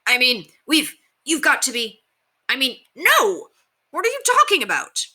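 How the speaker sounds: a guard caught off guard, tripping on his words, pitch climbing; flustered guard. A narrator's reading. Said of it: "tried to snap himself from his confusion".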